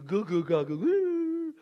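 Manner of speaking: slow, lightly cooing "goo goo gaga goo"; a hint of cooing to a baby; baby talk.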